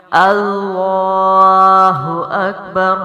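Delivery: اللہ اکبر slow, heavy ل and heavy ر; tajweed takbir. Shown as a demonstration. Teacher modelling the takbir, slow recitation. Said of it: "اور ادائیگی کیا ہوگی؟".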